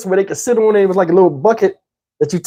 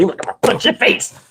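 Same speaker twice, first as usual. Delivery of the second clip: softened, airy punch threat; lightly voiced, almost whispered threat; mock friendly threat; 2 semitones higher, 4 dB quieter than usual. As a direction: imitate an uncle making a toothless threat. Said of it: "So friendly".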